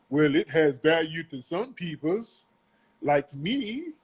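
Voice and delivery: put-on voice mimicking his reply, rising at the end; mock quote in an altered voice; mocking imitation. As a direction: mimic him mockingly, lifting the last words. Mocks the man's feeble reply.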